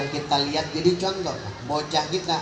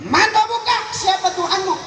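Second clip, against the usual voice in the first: much higher, louder stern angel; angel questioning; 3 dB louder than usual. Retold or imitated. Grave angel questions the dead man, stern and booming. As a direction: demand it from above like an interrogating angel. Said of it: "ditanya sama malaikat".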